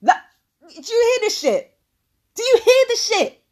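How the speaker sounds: high-pitched disbelief to the audience; incredulous appeal. Real, not acted. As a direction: ask them in open disbelief.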